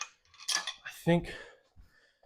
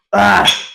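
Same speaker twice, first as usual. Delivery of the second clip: exertion gasp; 8 dB louder than usual. Real, not acted. Finishing a hard set.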